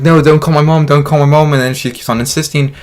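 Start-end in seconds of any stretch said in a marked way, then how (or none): 0.0-1.5 loud desperate plea, not yet yelling; urgent loud begging before he snaps; pleading. Retold or imitated. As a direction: beg her not to call. Begging babysitter again.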